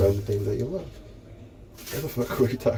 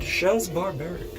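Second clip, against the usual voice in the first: brand announcer; 9 semitones higher, slower than usual. Acted. Announcing own brand name.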